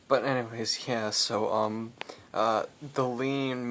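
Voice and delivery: normal talking voice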